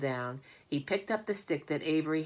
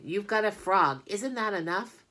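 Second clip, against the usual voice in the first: scolding; 6 semitones higher, 5 dB louder than usual. Acted. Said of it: "commanded Fern".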